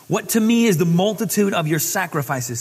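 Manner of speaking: loud, proclaiming Bible reading; booming scripture proclamation. Acted. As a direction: read it out like a prophet's call. Voicing God's words.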